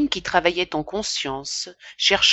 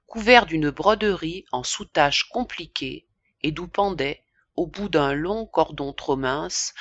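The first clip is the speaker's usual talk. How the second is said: ordinary delivery, nothing marked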